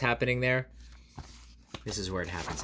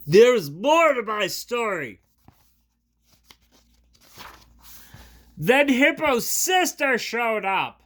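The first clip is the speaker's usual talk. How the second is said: louder, higher, slow elephant voice; Gerald; 10 semitones higher, 10 dB louder, slower than usual. Acted. Gerald spins his story to Piggy, slow loud. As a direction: drag out the tale.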